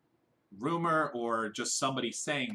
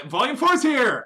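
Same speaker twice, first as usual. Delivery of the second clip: loud, high excited announcement; excited shout; 14 semitones higher, 12 dB louder than usual. Real, not acted. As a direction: shout it out with glee. Announcing the new volume.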